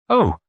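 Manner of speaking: high startled little oh; mimicking her startled oh; mock exclaim. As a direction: imitate a sudden faint cry. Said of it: "just like that".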